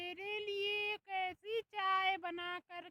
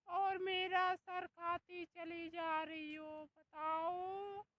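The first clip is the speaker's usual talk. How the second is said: normal talking voice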